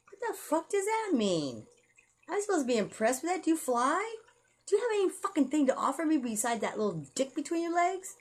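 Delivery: mock the pickup line to pieces; incredulous scorn. Real, not acted. Mocking men who only say they want her, high scornful disbelief.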